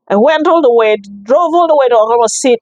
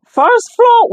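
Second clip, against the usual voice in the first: retold surprise; 13 semitones higher than usual. Realising they are on wrong floor.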